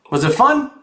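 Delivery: plain reading voice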